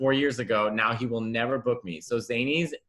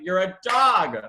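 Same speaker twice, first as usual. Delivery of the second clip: mock exasperation; 5 semitones higher, 6 dB louder, slower than usual. Mock exasperated retort to the dog.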